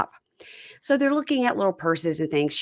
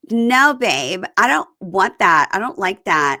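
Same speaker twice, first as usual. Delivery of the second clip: higher, louder imitation of revulsion; hammed-up grossed-out protest; mock disgusted whining; 4 semitones higher, 5 dB louder than usual. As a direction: whine like you might throw up. Acts out disgust at eating snails.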